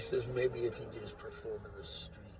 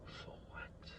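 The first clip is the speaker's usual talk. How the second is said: "For what?" whispered, softer and unvoiced; bitter whisper; 11 dB quieter than usual. Real, not acted. Asking his viewers again, bitter whispered "For what?". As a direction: breathe "For what?" to yourself. Repeats question, second time whispered.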